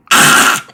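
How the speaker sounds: harsh mock rat shriek; rat screech. Game master as rat glaring at hunters, screech. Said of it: "looks at the hunters and then goes".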